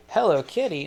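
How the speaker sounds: botched re-do of greeting the cat; wrong take. Shown as a demonstration. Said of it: "No, no, not like that".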